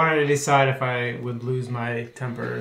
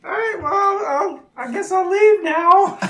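put-on old man voice; 6 dB louder than usual. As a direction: back off like a nervous elder. Old monk retreating.

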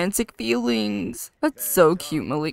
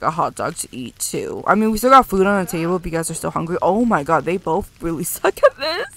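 usual speaking voice

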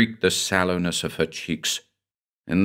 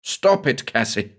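a sharp, much higher order; a sharp higher snap, then her name; snapped; 4 semitones higher than usual. Acted. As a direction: stop her hard. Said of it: "I snapped".

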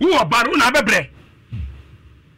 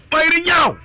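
exclaimed shout; 10 semitones higher than usual. Retold or imitated. Exclaiming in retold story.

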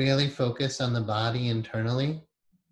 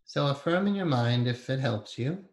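guided meditation. Acted. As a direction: ease them inward with long pauses.

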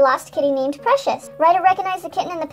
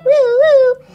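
high, loud imitated siren whoops; siren alarm; 7 semitones higher, 8 dB louder than usual. A narrator's reading. Ryder summoning the pups, loud siren imitation. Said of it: "sounded the Paw Patrol alarm".

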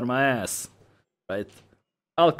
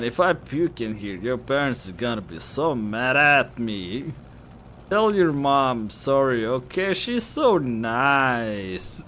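put-on slow, slightly raised drunken drawl; rambling drunk girl; 3 semitones higher, 4 dB louder, much slower than usual. Drunk girl asking friend to apologise to mom, slow gush. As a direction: gush sloppily, stretching the last words. Voices drunk character in game.

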